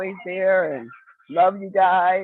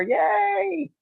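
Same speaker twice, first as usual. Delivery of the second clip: excited cheer; 13 semitones higher than usual. Real, not acted. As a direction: squeal a happy yay, way up high. Cheering her sister's name.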